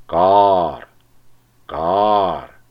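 short mournful caws; crows cawing. A narrator's reading. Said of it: "gombolkoztak be még jobban a varjak". (károgó varjak)

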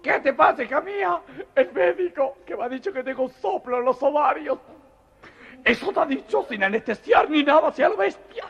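high falsetto outraged mother; mother confronts the doctor, high and indignant. Acted. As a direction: scold the doctor in a shrill falsetto.

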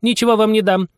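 very high, huffy refusal; offended mouse. A narrator's reading. Mouse refusing Anya's help, offended.

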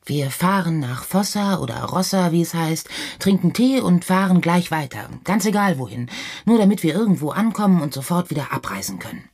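mounting, exasperated outburst; working into anger. A narrator's reading. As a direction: work yourself up into a sarcastic tirade. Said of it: "bring dich nicht künstlich in Wut".

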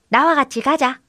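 lightly voiced copycat goat; mimicking goat. A narrator's reading. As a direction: repeat it as a little talking goat. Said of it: "그대로 흉내를 냈습니다".

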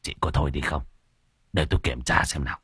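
low curt reprimand; impatient scolding. A narrator's reading. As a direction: cut the wailing woman off impatiently. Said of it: "sốt ruột mắng".

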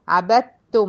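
tajweed demo; recited merge. Shown as a demonstration. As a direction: press عبدتم so د vanishes. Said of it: "من الب على الت المشددة".

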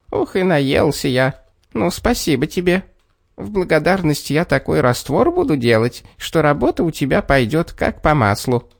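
a hint of a tiny contented man; little man voice. A narrator's reading. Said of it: "приговаривая".

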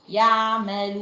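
يعملون with the long ending sent through the nose; nasalised ending. Shown as a demonstration. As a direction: drag out يعملون with a wrong nasal hum. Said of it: "مطلعين صوت من أنفهم".

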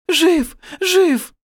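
very high, loud tearful cries; high, weeping exclamations; crying. A narrator's reading. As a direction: cry out as the overwhelmed wife. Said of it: "плакала рядом Таиса".

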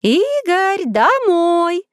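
high, long sung-out call; parent's drawn-out summons; calling children home. A narrator's reading. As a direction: holler from a window to the yard. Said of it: "звали детей".

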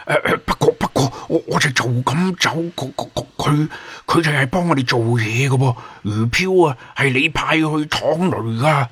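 high, stammering, anxious protest; flustered. A narrator's reading. Tongue-tied Tofu protesting to the leader, flustered. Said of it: "佢啊急啊".

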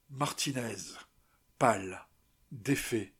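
ordinary delivery

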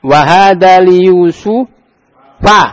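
slow, drawn-out Arabic recitation; slow teacher demo; slow model reading. Shown as a demonstration. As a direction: recite slowly for the class to repeat.

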